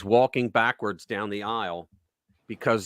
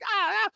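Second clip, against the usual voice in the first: put-on squeaky kid yelp; 3 dB quieter than usual. Kid cowed by mother.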